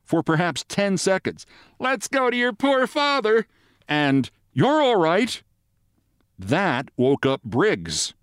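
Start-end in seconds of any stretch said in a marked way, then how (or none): 1.8-3.5 acted cry of a panicking mother; shouting. A narrator's reading. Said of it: "They exchanged shout for shout". 4.5-5.4 high, mock shout of reassurance; shouting back. A narrator's reading. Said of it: "They exchanged shout for shout".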